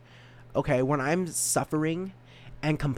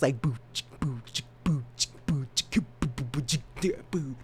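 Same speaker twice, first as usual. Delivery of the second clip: beatbox; 4 dB quieter than usual. Shown as a demonstration. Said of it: "little beatbox".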